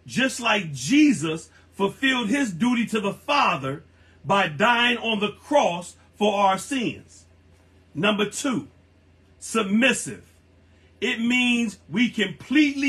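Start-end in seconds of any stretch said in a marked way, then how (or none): none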